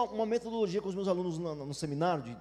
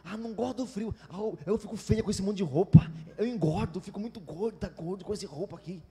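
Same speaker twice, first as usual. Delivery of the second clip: fussy complaining; 3 semitones lower, faster than usual. Acted. Preacher mimicking a believer who hates winter clothes, whining. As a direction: fret and moan like a spoilt complainer. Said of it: "Aí fica reclamando da temperatura".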